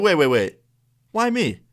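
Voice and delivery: retold high-pitched objection; startled protest. Student suddenly blamed by the teacher, startled protest. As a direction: pitch leaping up in disbelief.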